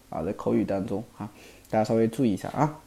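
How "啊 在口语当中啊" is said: ordinary delivery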